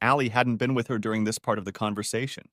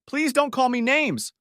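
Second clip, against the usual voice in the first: higher, slow desperate plea; begging; 4 dB louder than usual. Acted. Mother begging her daughter to stop, slow high plea. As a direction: beg them to stop. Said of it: "Linda begged".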